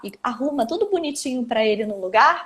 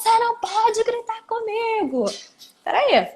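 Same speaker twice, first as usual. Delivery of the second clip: shouting parent; 9 semitones higher than usual. Retold or imitated. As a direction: play the irritated parent yelling back. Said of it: "falam assim".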